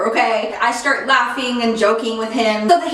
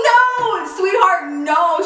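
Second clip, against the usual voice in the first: emphatic no; 8 semitones higher, slower than usual. Retold or imitated. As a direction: shout the no, then soften on sweetheart. Nurse's emphatic reassurance.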